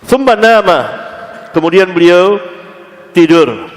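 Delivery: high, loud ringing delivery; emphatic recitation. Real, not acted. Preacher reciting and translating the hadith, ringing. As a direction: proclaim the text then its meaning.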